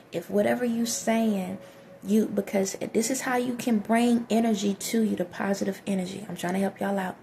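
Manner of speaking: normal talking voice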